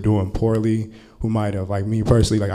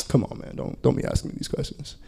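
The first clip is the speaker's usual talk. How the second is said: hushed, reluctant plea; quiet deflection; 2 semitones higher, 7 dB quieter than usual. Retold or imitated. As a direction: imitate a student quietly backing away. Student deflects a personal question.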